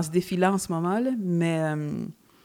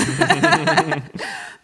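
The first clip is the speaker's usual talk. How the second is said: laughing; 10 semitones higher, 6 dB louder than usual. Real, not acted. Laughs after own remark.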